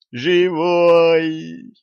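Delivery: high, long, jubilant cry; ecstatic yell. A narrator's reading. Girl finds her master alive, yelling in delight. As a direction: shriek it out with delight. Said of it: "с восторгом завопила".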